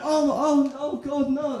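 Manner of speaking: high, alarmed gasping; startled bystanders crying out; mock alarm. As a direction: onlookers panicking as a child is left behind. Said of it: "everyone else around is like".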